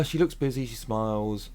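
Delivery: normal talking voice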